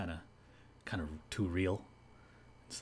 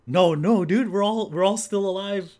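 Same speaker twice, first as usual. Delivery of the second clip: much higher, louder fast host banter; hammed-up Sanma host; 8 semitones higher, 10 dB louder than usual. Impersonator as Sanma teasing Nagahama, loud and high. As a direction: egg her on like a TV comedian. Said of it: "Sanma, he's like".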